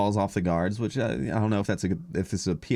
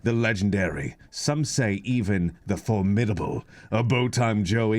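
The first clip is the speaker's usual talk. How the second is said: announcer intro; 2 semitones higher than usual. Acted. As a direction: present the hosts like a ring announcer. Hosts' grand podcast intro.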